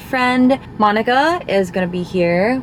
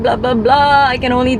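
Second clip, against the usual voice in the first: dismissive blah blah; 5 semitones higher, 4 dB louder than usual. Retold or imitated. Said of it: "telling myself".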